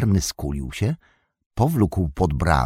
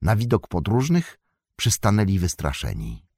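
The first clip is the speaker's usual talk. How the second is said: plain reading voice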